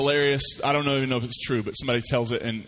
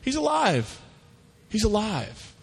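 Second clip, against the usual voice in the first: mock excited; 8 semitones higher than usual. Disciples repeating the news.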